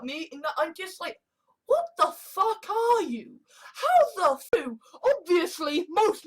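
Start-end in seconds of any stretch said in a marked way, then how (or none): 1.7-4.8 high-pitched exasperated disbelief; baffled outburst. Acted. Speaker confronting someone he can't stand, baffled outburst. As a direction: demand it, pitched way up.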